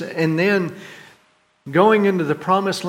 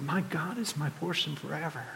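soft, hushed, sure of God; quiet trust; 14 dB quieter than usual. Retold or imitated. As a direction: say it gently, with settled faith. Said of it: "Do you hear the difference?".

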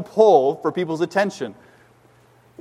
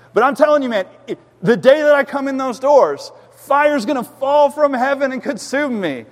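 raised, hammed-up proclamation in another man's voice; joking gym guy's boast; 8 semitones higher, 4 dB louder than usual. Gym guy teasing the pastor about coming to church, hammed-up boast. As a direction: imitate a man jokingly declaring certain doom, high and emphatic.

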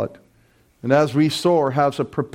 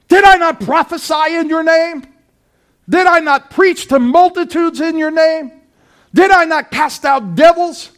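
high, loud, impassioned pulpit cry; fiery preaching; 12 semitones higher, 8 dB louder than usual. Acted. Preacher warning the congregation about false believers, fiery loud preaching. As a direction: preach it out full force to the congregation.

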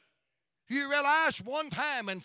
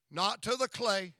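ordinary talk

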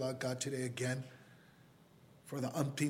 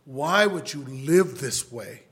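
slow, loud appeal; pleading; 7 dB louder, much slower than usual. Retold or imitated. Preacher to his brother, slow insistent pleading. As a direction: plead firmly, stretching the words. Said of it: "I've pleaded with my whole family".

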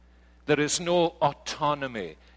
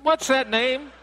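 high, louder puzzled asking; puzzled caller; 14 semitones higher, 6 dB louder than usual. Retold or imitated. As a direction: ask like a confused clerk on the phone. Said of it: "difficult to pronounce your own name".

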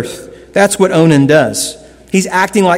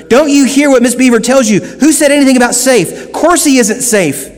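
indignant Mr Beaver; 7 semitones higher than usual. A narrator's reading. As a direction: scold the girls for asking if he is safe. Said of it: "said Mister Beaver".

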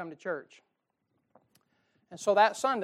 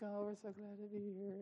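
fake cheer; 3 semitones higher, 15 dB quieter than usual. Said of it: "you come like".

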